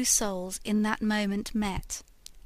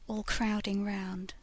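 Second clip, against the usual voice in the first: plain reading voice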